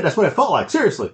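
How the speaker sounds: usual speaking voice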